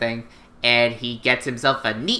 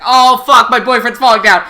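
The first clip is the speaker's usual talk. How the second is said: higher, loud panicked shout; mock panic; 8 semitones higher, 11 dB louder than usual. Suzaku seeing Lelouch fall, loud panicked shout. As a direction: shout it in sudden alarm. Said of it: "Suzaku's like".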